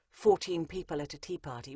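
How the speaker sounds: normal talking voice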